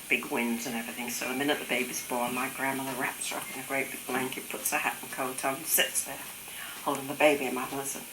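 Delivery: usual speaking voice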